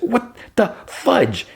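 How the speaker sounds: slow, high, word-by-word disbelief; shocked outburst. Retold or imitated. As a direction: squeal each word separately in mock shock. Jumps back from sudden yell.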